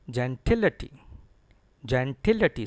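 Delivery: gentility as jen-TIL-uh-tee, TIL punched; stress on TIL. Shown as a demonstration. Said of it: "Stress is on T I L".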